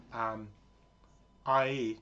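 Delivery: normal talking voice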